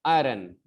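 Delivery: "आयरन" compact, a-run; changed pronunciation. Shown as a demonstration. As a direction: say "आयरन" without a full eye sound. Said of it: "ऐसे बोलना है".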